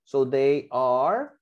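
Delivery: each word drawn out slowly for the class; slow, careful teacher dictation. Shown as a demonstration. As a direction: dictate it slowly so they can write. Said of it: "tengo que escribir acá".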